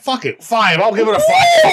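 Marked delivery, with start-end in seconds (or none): 1.2-1.7 much higher and louder, yelled out; high-pitched shout. Real, not acted. Host yelling his final beer rating, way up high. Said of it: "that was so high pitched".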